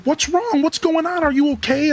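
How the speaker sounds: high, worried grandma checking in. Retold or imitated. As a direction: ask like a grandma finding her grandkid in tears. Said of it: "And my grandma's like".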